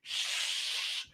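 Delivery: unvoiced shush. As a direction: hush them with a whisper. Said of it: "says Grandpa".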